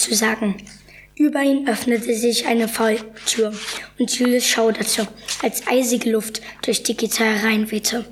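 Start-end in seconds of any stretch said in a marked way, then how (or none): none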